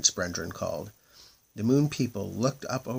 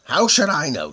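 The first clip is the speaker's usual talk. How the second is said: loud, much higher indignant demand; grumpy little man demanding, loud and high; 7 semitones higher, 9 dB louder than usual. Acted. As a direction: throw the question back at him. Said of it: "demanded the little bent man".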